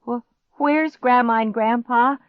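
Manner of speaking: high, loud stuttered question; frightened stammer. A narrator's reading. Boy hears monster overhead, stammering. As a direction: stammer it in fear. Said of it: "he stammered".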